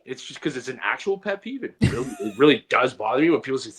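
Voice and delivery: ordinary delivery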